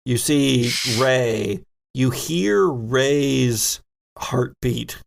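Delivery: usual speaking voice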